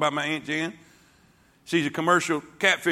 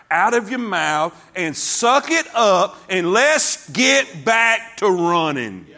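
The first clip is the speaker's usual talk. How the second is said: slow and louder, building up; fiery preaching; 2 semitones higher, 5 dB louder, slower than usual. Acted. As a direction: drive every word home. Preacher at full force.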